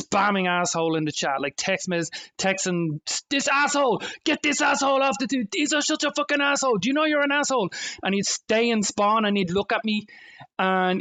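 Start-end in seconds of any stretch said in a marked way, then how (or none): 3.3-7.7 mock angry player. Retelling an angry player's chat insults.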